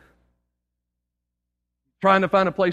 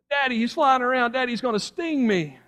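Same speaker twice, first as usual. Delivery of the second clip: high, whining plea to Daddy; mock panicked child's cry; frightened little girl; 6 semitones higher than usual. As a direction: imitate a scared child, pitch sliding down at the end. Preacher voices the frightened little girl.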